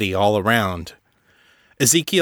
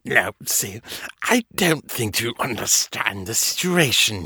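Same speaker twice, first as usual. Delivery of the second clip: menacing villain voice. Acted. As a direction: gloat like a cocky monster. Villain taunting the women.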